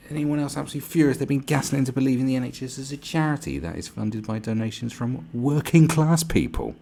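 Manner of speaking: high, loud mock indignation; mock outrage. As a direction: read it out as an over-the-top furious complaint. Reads the furious post aloud.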